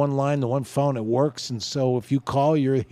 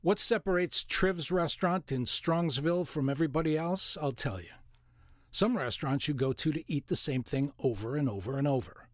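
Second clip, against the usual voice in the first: ad read; 2 semitones higher, 5 dB quieter than usual. Acted. Reads restaurant ad.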